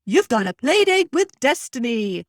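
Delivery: hammed-up attempt at a character accent; Lotso. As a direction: put on a bad villain accent, loudly. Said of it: "That was me pretending to be Lotso".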